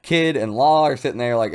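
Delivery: ordinary talk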